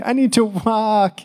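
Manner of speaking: a spent runner begging to stop; high drawn-out tired whimper; exhausted plea. Retold or imitated. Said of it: "while I'm like".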